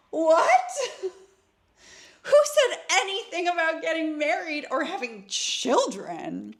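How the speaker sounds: high, loud incredulous cry; shocked outburst. Retold or imitated. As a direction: blurt it out, flabbergasted. Shocked retort to marriage talk.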